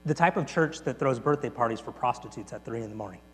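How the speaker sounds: soft, low, quick understated answer; quiet reply. Retold or imitated. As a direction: deliver it softly, with simple conviction. Tony's quiet punchline reply.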